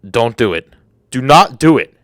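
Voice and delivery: ordinary delivery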